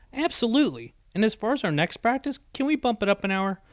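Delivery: bright and high; eager relief. A narrator's reading.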